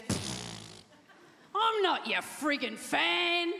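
slow, scornful scoff; mock disdainful heckler; dismissive scoff. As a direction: sneer it slowly, unimpressed. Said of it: "she just looked back at me and she goes".

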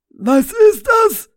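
high, loud, slow alarmed ask; alarmed question. Acted. Rainer eyeing the leather gear, high alarmed question. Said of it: "fragte Rainer".

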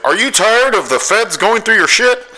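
high radio pitchman voice; lightly voiced cheesy commercial guy; a hint of a sleazy ad announcer; mock radio ad voice. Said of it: "the guy's voice was just".

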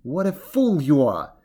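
loud, exasperated tiger; interrupting tiger. A narrator's reading. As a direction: cut in with a scolding. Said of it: "interrupted the tiger".

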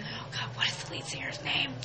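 hushed whisper, searching her memory; whisper to self. Real, not acted. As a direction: whisper the question under your breath. Forgets name mid-story.

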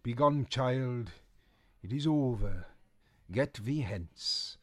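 slow archaic dismissal; stern old witch, slow. Acted. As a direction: send the child away grandly. Boris voiced.

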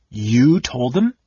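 stunned disbelief; gasped. A narrator's reading. Kitiara gasping at Dalamar's confession. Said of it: "Kitiar gasped".

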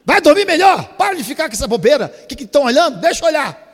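loud, high, defiant bickering; mock indignant shout. Preacher shouting at onlookers over his full plate. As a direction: snap back over the plate. Voicing himself defending the meal.

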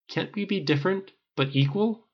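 earnest question. Acted. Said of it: "then asked".

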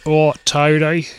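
drawn-out mock Aussie accent; Australian accent. Podcaster trying out the accent, mock Australian. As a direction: imitate an Australian, vowels stretched slowly. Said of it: "difficult to do that accent".

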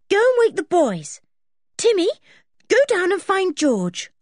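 high, loud call across the house. Acted. Anne sending the dog to fetch George, calling out high. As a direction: call the dog and the boys from bed.

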